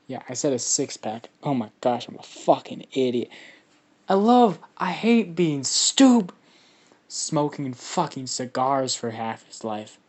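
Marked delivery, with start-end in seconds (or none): 4.1-6.3 loud, high exasperated cry; self-mocking outburst. Real, not acted. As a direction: burst out, fed up with yourself. Exasperated at own slip of words.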